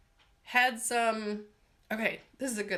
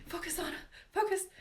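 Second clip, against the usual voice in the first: high-pitched pep talk to herself; playful little self-reprimand; self-scolding; 10 semitones higher, faster than usual. Real, not acted. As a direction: pull yourself back on track, pitched up. Scolds herself back on track.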